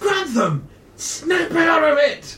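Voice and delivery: slow, high urgent shout. Acted. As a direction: yell to wake him up. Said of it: "The voice suddenly breaks through".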